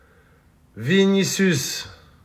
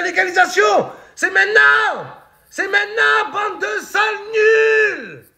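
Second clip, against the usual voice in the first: rallying shout; 11 semitones higher than usual. Pretend. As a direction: rouse the stadium to its feet. Rallying the Madrid crowd.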